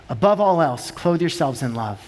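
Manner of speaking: hushed scripture recap; solemn scripture reading. Real, not acted. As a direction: close on the verse gently.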